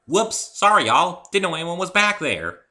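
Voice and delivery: hearty southern farm mare; southern accent. A narrator's reading. Southern accent as the farm pony apologizes to the visitors. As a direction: apologize in a thick southern drawl. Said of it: "touched with a thick southern accent".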